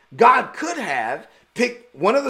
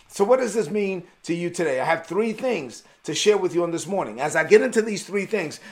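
ordinary talk, nothing marked

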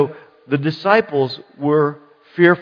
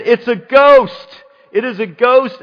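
terrified cry; 8 semitones higher, 8 dB louder than usual. Acted. Preacher playing disciples who see Jesus on the sea, terrified. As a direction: cry out in acted terror. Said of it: "they cried out in fear".

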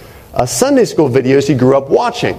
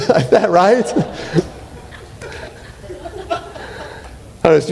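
laughing; 5 semitones higher, 4 dB quieter, slower than usual. Real, not acted. Laughing at the Seinfeld story.

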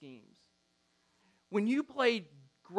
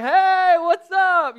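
high, loud greeting across the stands; a boy calling out to someone he knows; friendly call; 11 semitones higher, 14 dB louder, much slower than usual. Retold or imitated. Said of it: "and he's like".

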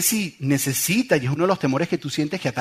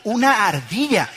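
slow, higher-pitched incredulous question; puzzled echo; 5 semitones higher, slower than usual. Retold or imitated. Husband replays questioning his screaming wife, puzzled. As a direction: draw it out in disbelief.

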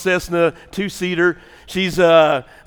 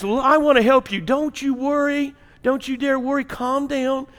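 calming voice; 7 semitones higher than usual. Acted. Air traffic controller soothing panicked passenger, calming voice. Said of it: "He's a calming voice".

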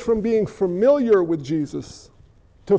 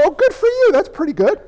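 much higher, hammed-up approving wife; mock pleased wife; 8 semitones higher than usual. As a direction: play a wife pretending to be pleased. Imitating the wife's approval.

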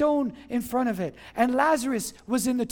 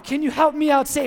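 ordinary talk, nothing marked

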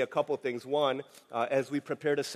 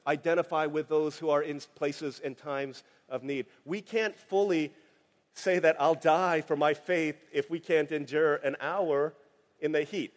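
ordinary talk

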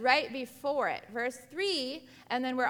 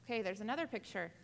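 ordinary talk

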